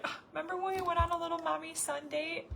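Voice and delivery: high, cooing fond recollection; acting out a wistful "aww, remember" moment; fond reminiscing. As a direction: coo it sweetly, pitched high. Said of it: "watch these videos back and be like".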